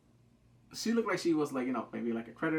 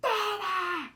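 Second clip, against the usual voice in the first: excited cheering; much slower than usual. Retold or imitated. Fan cheering at the drama's big moment, excited high cheer. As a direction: shriek with delight.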